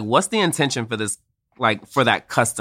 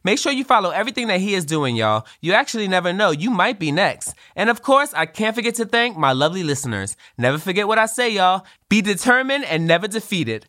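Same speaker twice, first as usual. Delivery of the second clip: show outro; 6 semitones higher than usual. Acted. Show sign-off.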